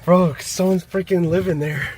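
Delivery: can't believe someone lives there; shocked. Real, not acted. Shocked at squatter.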